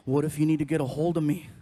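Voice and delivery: put-on low, loud voice of an arguing teen; teenage son. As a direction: imitate a son pushing back.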